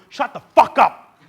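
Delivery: explosive, high-pitched yell, drawn out; furious outburst as an indignant bull; angry shout. Acted. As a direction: roar it slowly to shut someone down. Acted bull yells back at speaker.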